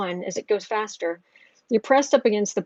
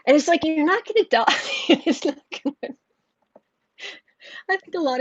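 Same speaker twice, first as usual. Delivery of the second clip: laughing; 7 semitones higher than usual. Real, not acted.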